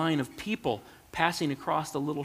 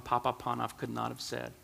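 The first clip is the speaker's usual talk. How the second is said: usual speaking voice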